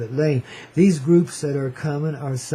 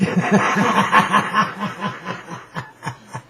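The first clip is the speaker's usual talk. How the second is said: hearty laugh; 3 semitones higher than usual. Real, not acted. Laughs after own joke.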